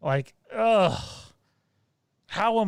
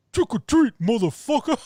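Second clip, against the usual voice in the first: high, loud rapper growl of a taunt; a high, shouted rapper-style threat; 9 semitones higher, 4 dB louder than usual. Pretend. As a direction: bark it like a hype rapper. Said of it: "Busta Rhymes style".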